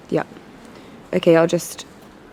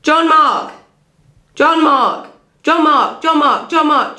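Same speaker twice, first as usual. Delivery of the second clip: raised, high repeated calls of John Mark; talking loudly; 5 semitones higher, slower than usual. Real, not acted. Shouting Jean-Marc's name at the man who doesn't understand him. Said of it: "I can talk loudly too".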